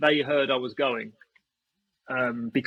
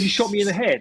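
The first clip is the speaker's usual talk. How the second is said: higher-pitched retold protest; mock indignant complaint; 7 semitones higher than usual. Hit player repeating his complaint to the shooter, mock indignant. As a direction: insist like an aggrieved player.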